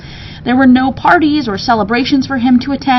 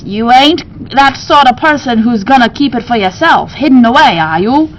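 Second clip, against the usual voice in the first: high, loud gruff-talking shopkeeper; bird keeper voice; 4 semitones higher, 4 dB louder than usual. Acted. Bird keeper questioning Ebenezer, high and loud. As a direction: press him with a folksy high voice.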